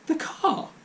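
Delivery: stunned, high and breathless; shocked gasp. A narrator's reading. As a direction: choke out the words in shock. Shocked father.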